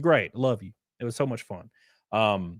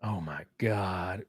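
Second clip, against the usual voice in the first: awed gasp; 6 semitones lower, 10 dB quieter, much slower than usual. Real, not acted. Awed reaction to Doc's return.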